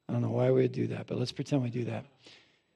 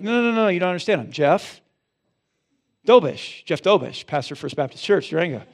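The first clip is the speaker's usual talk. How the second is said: higher and louder, puffed-up protest; mock self-important insistence; 3 semitones higher, 5 dB louder than usual. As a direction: play the pompous big shot. Insisting to palace guard.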